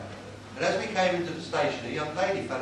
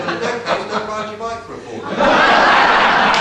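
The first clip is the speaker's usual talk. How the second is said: laughing through the punchline; 5 semitones higher, 14 dB louder, slower than usual. Real, not acted.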